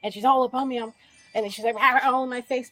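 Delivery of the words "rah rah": a loud mock roar of rah rah; mock yelling. Retelling how the woman yelled in her face, a loud mock roar. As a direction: bark it like someone in your face.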